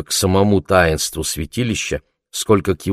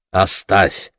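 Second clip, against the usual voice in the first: short, firm dismissal, hardly raised; curt cut-off. A narrator's reading. Bardusha stops old Kunta's talk of burial, curtly. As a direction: cut the subject off. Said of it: "отрезал Бардуша".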